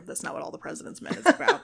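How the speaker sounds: fast, laughing at her own joke; laughing correction. Real, not acted. Host laughing as she corrects her own joke. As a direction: giggle through the retraction. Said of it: "That's not true".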